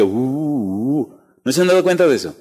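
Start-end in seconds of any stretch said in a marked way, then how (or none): 0.0-1.2 low, soft hollow uh uh; soft eerie rumbling hum; earthquake rumble. Retold or imitated. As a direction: mimic the strange sound of the earth shaking. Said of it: "suena un sonido raro como de que".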